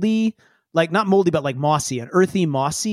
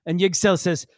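ordinary delivery

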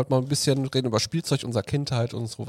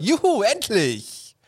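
slow, high, drawn-out cheer; excited cheer; 11 semitones higher, much slower than usual. Acted. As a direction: cheer it out like a kid. Cheering about toy day.

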